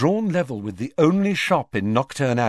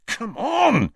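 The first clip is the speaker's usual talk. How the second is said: Ron, urging; 14 semitones higher, 3 dB louder than usual. A narrator's reading. Ron urging friends to follow.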